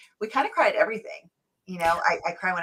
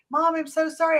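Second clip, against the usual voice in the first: lightly imitated sorry kid, much higher; apologetic child; 8 semitones higher than usual. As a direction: apologize to mom in a high, pitying voice. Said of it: "and they're like".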